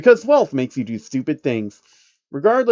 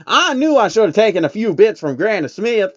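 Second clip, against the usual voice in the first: higher, louder cowgirl voice, frustrated; grumbling at herself, higher; Applejack; 8 semitones higher, 5 dB louder than usual. Acted. Applejack missing the train, higher frustrated cowgirl voice.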